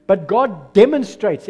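"demonstrates" punched loud and drawn out; stress on DEMONSTRATES. Real, not acted. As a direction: hit "demonstrates" hard and slowly. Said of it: "I put the emphasis this morning on the word demonstrated".

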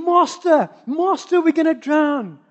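shouting. Retold or imitated. Disciples shouting to Jesus as the boat sinks, panicked shout. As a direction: cry out for the master to save them. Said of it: "they started shouting".

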